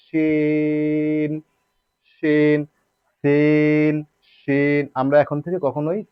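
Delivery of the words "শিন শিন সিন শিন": শিন and সিন each with a long held ee; three-beat ee in শিন, সিন; elongated vowel. Shown as a demonstration. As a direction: stretch every শিন and সিন on one level note. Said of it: "তিন আলিফ পরিমাণ টানতে হবে".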